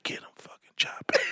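hushed whisper, sneaky; whispered order. Retold or imitated. Coach whispering to the team behind the mom's back. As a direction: whisper the curse so the parent won't hear. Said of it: "I said".

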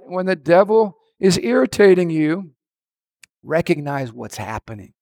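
ordinary talk

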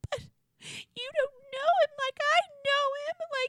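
mock sobbing whine, pitched very high; whiny crying Hannah. Hannah defending him to her parents, in a high mock sob. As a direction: overdo the tearful protest. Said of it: "And Hannah's just like".

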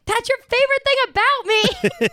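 lightly voiced sassy heroine; mock character quote. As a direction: toss it off as a sassy comeback. Quoting character's snarky comeback.

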